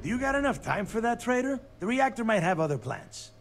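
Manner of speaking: high, loud, fast taunt; mocking shout. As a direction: jeer at the traitor fast. Taunting the traitor under pressure.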